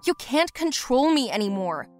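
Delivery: loud, defiant outburst; angry defiance. Acted. Daughter to mother in a fight, loud defiance. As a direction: throw it at her angrily. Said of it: "we had a fight".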